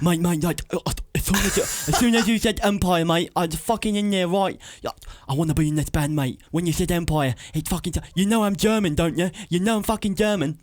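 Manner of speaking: put-on high-pitched laughing German accent; Mark Corbyn impression. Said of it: "that's my Mark Corbyn impression".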